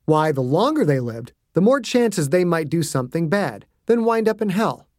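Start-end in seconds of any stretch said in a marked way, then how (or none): none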